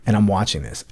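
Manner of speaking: ordinary talk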